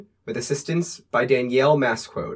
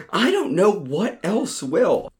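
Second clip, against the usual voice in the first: ordinary delivery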